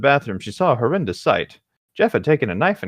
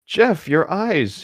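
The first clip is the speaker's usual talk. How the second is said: slow dramatic read; 7 semitones higher, much slower than usual. Acted. Mother reading, dragged out.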